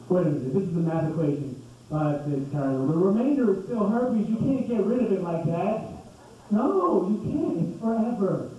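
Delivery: usual speaking voice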